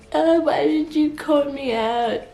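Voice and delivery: mock her like a sulking rival; mocking imitation. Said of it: "getting all mad".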